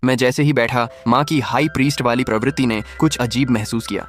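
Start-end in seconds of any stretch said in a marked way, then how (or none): none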